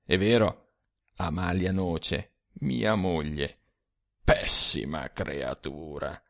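a weary ghost sharing bitter secrets; ghostly old man. A narrator's reading. Dead Sturzi confides to the young man, low and soft.

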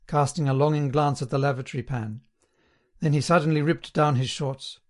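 ordinary delivery, nothing marked

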